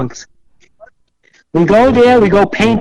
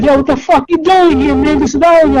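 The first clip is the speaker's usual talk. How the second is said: hostile gang; 8 semitones higher than usual. Retold or imitated. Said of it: "They're like".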